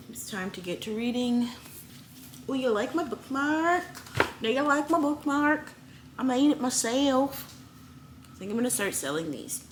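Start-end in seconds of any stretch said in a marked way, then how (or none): 2.5-3.8 excited. Acted. Showing off bookmark. 4.4-7.5 high bright showing-off voice; sing-song proud tone, pitched up; playful put-on voice. Bookmark repeated in put-on voice.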